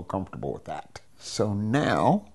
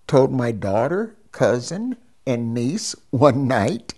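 ordinary delivery

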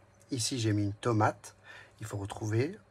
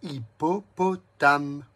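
"Hippopotame" drawn out slowly, a touch louder; slow syllable count; 2 semitones higher, 6 dB louder, much slower than usual. Shown as a demonstration. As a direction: stretch "Hippopotame" so each syllable sounds. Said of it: "Quatre syllabes".

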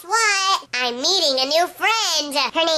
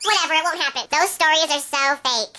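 usual speaking voice

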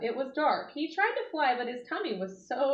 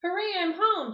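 high, louder joyful cheer; 9 semitones higher, 5 dB louder than usual. Acted. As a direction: cheer like you made it home. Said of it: "cheered the greedy bee".

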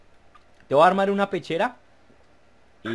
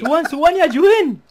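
higher, louder, slow urgent call; 8 semitones higher, 4 dB louder than usual. Real, not acted. Calling teammates for help.

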